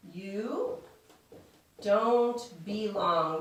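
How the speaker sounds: drawn-out words with a long gap; slow dictation. Shown as a demonstration. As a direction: say it slowly, as if writing it out. Slowly voices words while writing them.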